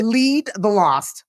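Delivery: spelling it out slowly; slow, deliberate repeat for clarity; slow and clear. Real, not acted. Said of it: "what was it?".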